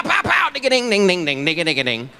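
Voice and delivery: high pows falling into rattling dings; imitated gunfire. President's imagined shootout, mock gunfire noises. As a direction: play shootout noises with your mouth.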